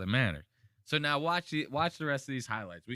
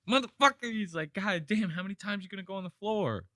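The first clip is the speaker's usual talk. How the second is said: high, exasperated mock outburst; pissed off; 9 semitones higher than usual. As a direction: imitate a fed-up boxer cursing his opponent. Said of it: "Anthony Joshua got pissed off".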